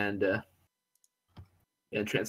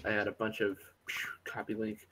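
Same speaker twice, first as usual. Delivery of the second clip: whispered aside to himself; muttering under breath; 6 dB quieter than usual. Real, not acted. Muttering to himself while pasting.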